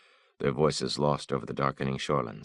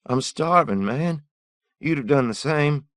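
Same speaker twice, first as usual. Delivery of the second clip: high, loud desperate begging; a ragged thief pleading at gunpoint; 14 semitones higher, 9 dB louder than usual. Acted. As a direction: beg for your life in a strained high voice. Thief pleading for his life.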